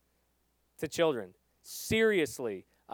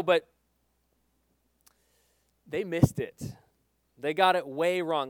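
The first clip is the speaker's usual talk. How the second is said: normal talking voice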